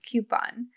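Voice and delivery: "Coupon" said as KYOO-pon; KYOO-pon pronunciation. Real, not acted. Insisting on her version to the co-host, "Coupon" as KYOO-pon. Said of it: "I definitely say coupons".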